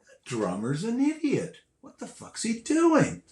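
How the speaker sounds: rising, incredulous scorn, slowed; mock disbelief at the drummer; scornful. Watching from beside the stage, scoffing at the drummer. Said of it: "I'm going".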